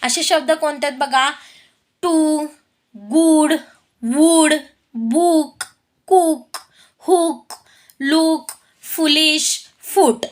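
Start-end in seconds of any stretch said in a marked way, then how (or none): 2.0-10.3 "गुड", "बुक", "लुक" with a clear oo vowel; "उ" sound word list. Shown as a demonstration. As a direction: say each word separately, oo sounded as in "टू". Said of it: "त्याचा उच्चार उच असा होतो". ("गुड", "बुक", "लुक" मधला उ स्पष्ट उच्चारून)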